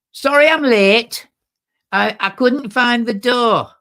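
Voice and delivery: high, slow, loud Manchester woman's voice; breezy Manchester latecomer barging in; Manchester accent. Retold or imitated. A late woman barging into prayers, in a loud Manchester accent. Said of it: "thick Manchester accent".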